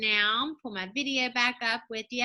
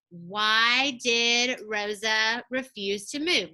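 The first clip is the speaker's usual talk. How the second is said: slow, careful model question; much slower than usual. Shown as a demonstration. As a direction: ask it slowly, word by word.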